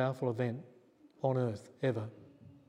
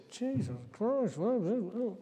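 higher, slow, stunned gasp of disbelief; shocked exclamation; 9 semitones higher, slower than usual. Retold or imitated. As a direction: play the man reeling in shock. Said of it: "he was really shocked".

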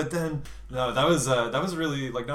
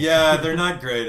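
laughing admission; 5 semitones higher, 9 dB louder, slower than usual. Real, not acted. Laughing at own accents.

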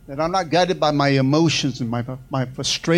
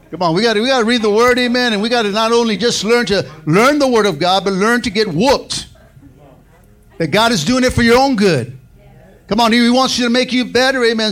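loud, high fired-up preaching; 6 semitones higher, 6 dB louder than usual. Acted. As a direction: preach it out with full force. Preaching at full force.

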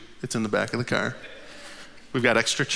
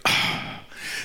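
a long voiceless sigh, softer; weary sigh; 5 dB quieter than usual. Real, not acted. Tired man resigned to borrowing a van, sigh. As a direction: let the tiredness out in one breath. Weary after mechanic's offer.

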